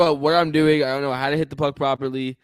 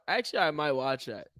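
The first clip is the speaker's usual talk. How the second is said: normal talking voice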